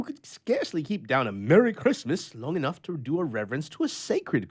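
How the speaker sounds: a lonely grouch airing his gripes; grumpy old bachelor. A narrator's reading. Bluff tells his adventure.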